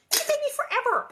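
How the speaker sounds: very high, loud squawk; exaggerated exasperation. Real, not acted. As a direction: shoot the voice way up in comic dismay. Exaggerating how long it will take.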